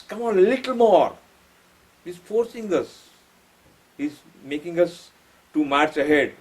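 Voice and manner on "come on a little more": high, pushing coach-like call; urging encouragement. Retold or imitated. Sri Aurobindo urging humanity upward, a high encouraging call. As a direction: push them on like a coach. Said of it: "He has encouraged us".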